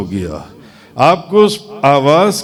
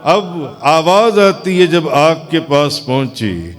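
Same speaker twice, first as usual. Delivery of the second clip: usual speaking voice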